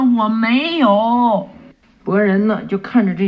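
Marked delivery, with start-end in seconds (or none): 0.0-1.5 slow, drawn-out, higher denial; shouting denial. Acted. Boy denying the girls' teasing, slow stubborn shout. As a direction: insist stubbornly, stretching it out. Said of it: "博人就大喊".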